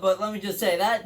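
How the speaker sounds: ordinary delivery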